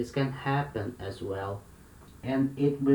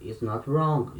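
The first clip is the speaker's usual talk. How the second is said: usual speaking voice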